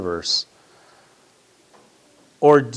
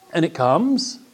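much higher and louder, driving hard; fiery preaching; 7 semitones higher, 7 dB louder than usual. Acted. Pastor warning the congregation, fiery sermon build. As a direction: hammer the point home.

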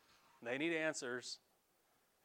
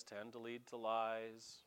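quiet, slowed echo of the line; softer echo; 5 semitones lower, 6 dB quieter than usual. Shown as a demonstration. Preacher repeating the proverb to the congregation, softer and slow. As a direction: repeat it softer, slow, letting lies sink in. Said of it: "lies tend to lead to lies".

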